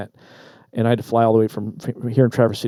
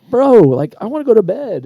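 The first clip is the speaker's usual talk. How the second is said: exasperated plea; 10 semitones higher, 5 dB louder than usual. Retold or imitated. Exasperated at friend starting conversation.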